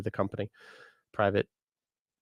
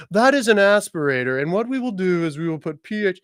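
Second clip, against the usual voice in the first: much higher, snooty lecturing voice; mocking stuffy professional; 9 semitones higher, 4 dB louder than usual. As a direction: parody a condescending old-school expert. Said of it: "yes, I am mocking the".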